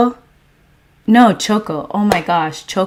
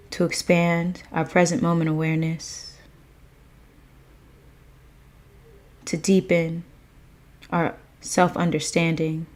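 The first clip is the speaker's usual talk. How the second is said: gratitude meditation; 2 semitones lower, 9 dB quieter than usual. Real, not acted. Streamer opening the livestream with thanks, hushed meditative intention. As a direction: offer each line quietly, fading softer to the end.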